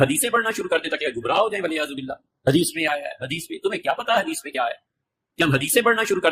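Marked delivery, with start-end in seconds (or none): none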